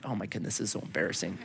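low, very soft mock mortification; embarrassed aside. Companion cringing in the doctor's office, embarrassed undertone. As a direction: mutter it under your breath. Acts out his embarrassed inner thought.

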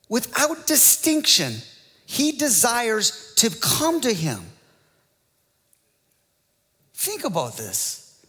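high, ringing preacher's exclamation; soaring pulpit wonder; impassioned preaching. Acted. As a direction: lift the congregation with awe. Preacher exults over God's love.

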